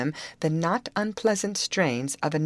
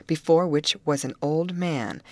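usual speaking voice

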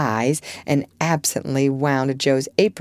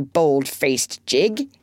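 mock scolding rhyme; much slower than usual. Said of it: "say with our friend Cock Robin".

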